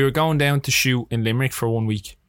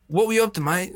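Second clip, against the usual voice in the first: higher, lightly imitated Aussie star; casual question with a hint of Australian; Russell Crowe; 7 semitones higher than usual. Crowe casually asks about the Fiver Friday pot, lightly imitated. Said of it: "Stop doing the voice".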